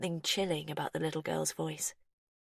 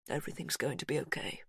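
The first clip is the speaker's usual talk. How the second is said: softer, quick hushed comfort; soft reassurance; 2 semitones lower, 7 dB quieter, faster than usual. A narrator's reading. Hugh reassuring his daughter, softly. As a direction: whisper comfort he doubts. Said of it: "he said to her".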